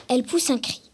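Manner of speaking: ordinary delivery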